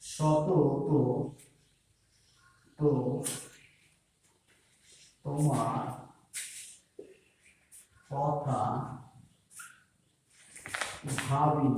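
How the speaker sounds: each word set apart by long pauses; slow poem recitation. Acted. Teacher slowly reciting a verse line to students.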